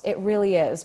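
usual speaking voice

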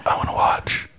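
creepy whispered aside. Acted. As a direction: whisper it like a voyeur. Teasing whisper while friends joke.

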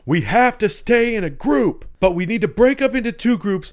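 high, loud hammed-up army officer giving orders; mock military guy. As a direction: bark orders in a squeaky mock soldier voice. Military guy orders group split.

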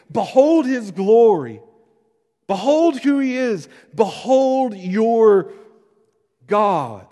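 loud, high proclamation, drawn out; exultant declaration. Acted. Preacher declaring John's message to all of us, loud and exultant. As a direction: proclaim it slowly at full voice. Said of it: "he's declared to all of us".

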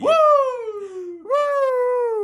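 very high, loud wrestling woo; Nature Boy woo. Pretend. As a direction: let out a Ric Flair woo. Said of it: "that fucking Nature Boy thing".

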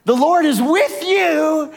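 angel bursting in on Mary's bedroom, thrilled to greet her; excited angel. Acted. Gabriel greets the teenage Mary with high excited joy. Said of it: "Gabriel showed up through the wall and said".

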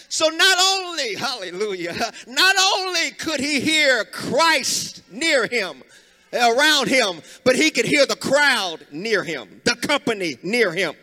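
loud, sung preaching cadence. Acted. Preacher whoops to the congregation in song-like cadence. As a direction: preach it with rising fervor.

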